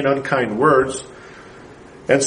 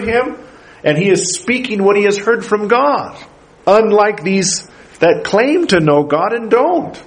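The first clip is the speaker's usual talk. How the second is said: rising preaching; 6 semitones higher than usual. Acted. Pastor pressing the congregation, preaching higher. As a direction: drive the claim home to the congregation. Preacher building to full force.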